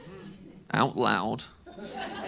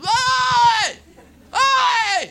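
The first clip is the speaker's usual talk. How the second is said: loud, high mock hollering; hammed-up yob shouting; imitated lads' yells; 6 dB louder than usual. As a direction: bellow it like rowdy lads across a bus. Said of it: "shouting at each other across the bus".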